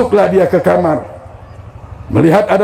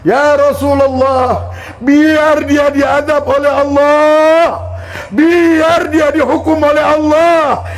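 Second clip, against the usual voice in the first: high, slow, shouted outburst; furious shout; 9 semitones higher, slower than usual. Acted. Old mother shouting her refusal at the Prophet, furious. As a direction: hurl the words out in bitter rage.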